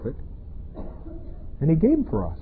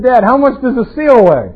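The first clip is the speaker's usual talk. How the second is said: put-on kids calling out; 8 semitones higher, 5 dB louder than usual. Kids ask dad.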